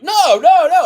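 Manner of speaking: high, loud imitation of someone objecting; mock protest. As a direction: shout it like your buddy refusing to listen. Acting out co-host's loud objection.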